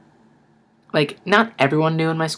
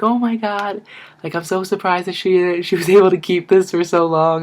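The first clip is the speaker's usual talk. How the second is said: high, gushing amazement that builds louder; amazed; 5 semitones higher, 4 dB louder than usual. Retold or imitated. Aunts marvel that Emily kept the secret, high and gushing. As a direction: imitate the aunts' amazed chatter.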